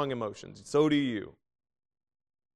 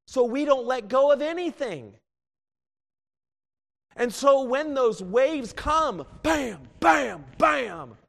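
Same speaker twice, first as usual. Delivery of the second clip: higher, louder, slow pounding bams; crashing waves; 12 semitones higher, 8 dB louder, slower than usual. Acted. Preacher to the congregation about life's storms, pounding loud. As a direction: hammer each bam like a wave hitting.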